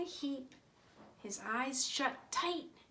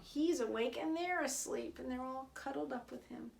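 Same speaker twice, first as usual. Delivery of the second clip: ordinary delivery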